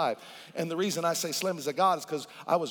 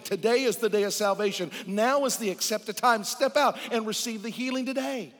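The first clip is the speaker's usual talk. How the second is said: preaching at full force; 4 semitones higher than usual. Acted. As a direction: drive the command home from the pulpit.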